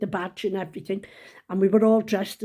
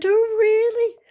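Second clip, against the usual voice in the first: much higher, louder impressed reaction; mock surprise; 7 dB louder, slower than usual. Headmaster hearing of her florist shop, imitated surprise. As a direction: swoop up high in polite astonishment.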